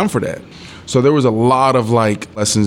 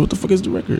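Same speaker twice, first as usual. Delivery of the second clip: higher, softer, thrown by the news; stunned disbelief; 8 semitones higher, 4 dB quieter, much faster than usual. Retold or imitated. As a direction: ask in sudden shock. Shocked question to teammate.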